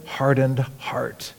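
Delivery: whispered slowly for weight; hushed whisper. Acted. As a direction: drop to a breathy whisper on hardened heart. Sermon stresses hard heart.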